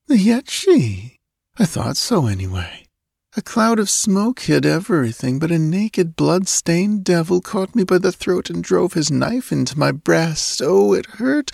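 put-on high, dreamy drowsy young woman; sleepy girl. A narrator's reading. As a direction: recall a nightmare half-asleep.

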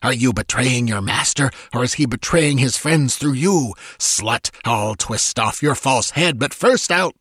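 villain hissing like a cobra; hissing fury. A narrator's reading. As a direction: hiss out the accusations at a traitor. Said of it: "the voice hissed like a cobra".

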